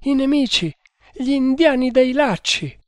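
high, wailing report; distraught. A narrator's reading. Traitor blaming the Indians to his master, distraught. Weeping traitor names the attackers.

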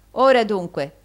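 ordinary delivery

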